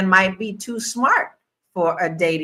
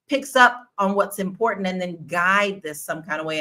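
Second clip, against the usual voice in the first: ordinary talk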